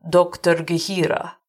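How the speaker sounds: ordinary delivery, nothing marked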